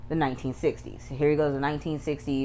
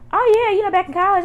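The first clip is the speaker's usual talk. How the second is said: much higher, louder, giddy put-on voice; high mock Sandy; 7 dB louder than usual. Recapping the show, mimicking Sandy's high giddy answer. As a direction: squeal it out like a carefree confession. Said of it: "Sandy was like".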